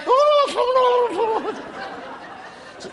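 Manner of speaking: high, loud helpless laughter; high cackling laugh. Real, not acted. Comedian collapses laughing at his own golf joke. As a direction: break down laughing mid-story. Teller cracks up.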